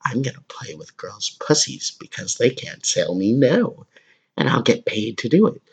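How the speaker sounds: imitating a creepy would-be gynecologist; mock creep voice. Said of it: "they grow up saying".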